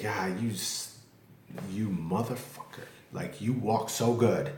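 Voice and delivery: quiet, hesitant imitation of a resentful viewer; imagined viewer's grudging reaction. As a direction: mutter it as a mock-envious onlooker.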